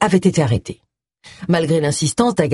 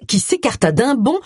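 ordinary delivery, nothing marked

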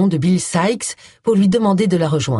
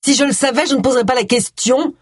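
high-pitched, exasperated outburst; indignant storming; 7 semitones higher than usual. A narrator's reading. Suspect retorting to the inspector, storming. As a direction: storm back at them, pitch raised. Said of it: "tempêta-t-elle".

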